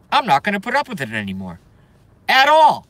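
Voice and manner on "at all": loud, high, emphatic burst; exasperated raised voice; emphatic outburst. Real, not acted. Refusing to put up with it.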